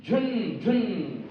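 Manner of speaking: zoom it past like a fast bike; playful motor sound; engine vroom imitation. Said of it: "बहुत तेज भगती है".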